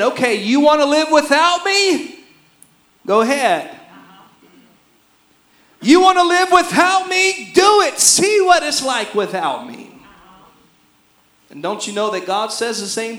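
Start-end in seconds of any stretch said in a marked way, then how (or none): 0.0-3.7 high, pointed dare as God; voicing God's challenge. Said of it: "God is saying". 5.8-9.9 higher and louder defiant dare; angry God. Acted. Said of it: "God is saying".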